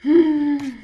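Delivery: loud, high sigh; exasperated sigh. Real, not acted. Sister refuses to let her tell the story, exasperated sigh.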